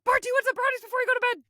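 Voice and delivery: high, loud and rushed sing-song; high fast mock Homer. Retelling the show, Homer bursting into Bart's room, high and fast. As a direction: rattle it off in a creepy-sweet high voice. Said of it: "Is like".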